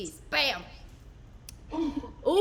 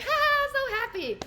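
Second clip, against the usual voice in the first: put-on happy baby; 12 semitones higher than usual. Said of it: "he was like".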